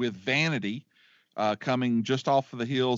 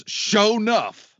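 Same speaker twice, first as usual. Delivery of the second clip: much higher and louder, stretched out slowly; relished name; 9 semitones higher, 7 dB louder than usual. Real, not acted. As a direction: announce the villain with a flourish. Naming the villain with relish.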